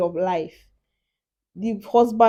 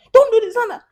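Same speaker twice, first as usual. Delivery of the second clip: put-on high, loud shout at a child; mock parent shout; 14 semitones higher, 10 dB louder, faster than usual. Parent shouting at a child in public, loud and high. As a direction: bark it like a parent scolding.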